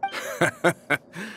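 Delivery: short bright chuckle, high; a quick happy laugh on greeting; light laugh. Acted.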